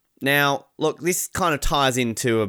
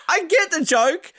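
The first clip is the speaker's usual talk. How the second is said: ordinary delivery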